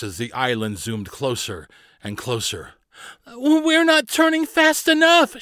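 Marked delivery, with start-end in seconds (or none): 3.3-5.4 high, loud, stretched-out cry; panicked. A narrator's reading. Carly watches the island zoom closer, crying out in panic. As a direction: wail it in rising panic. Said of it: "she panicked".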